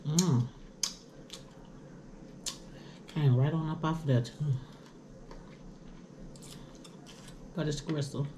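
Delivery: talking while eating chicken; picking meat off bone; mouth full, chewing. Real, not acted. Seen 2: Picks at wing bone, brings it to mouth, chewing between words.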